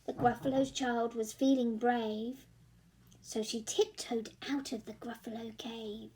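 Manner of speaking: ordinary delivery, nothing marked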